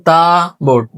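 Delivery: ordinary talk, nothing marked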